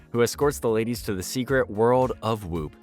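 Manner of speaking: normal talking voice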